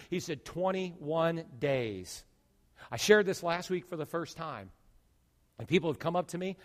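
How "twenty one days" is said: slow solemn God voice. Acted. God's answer, slow.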